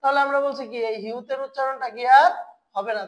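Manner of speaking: ordinary talk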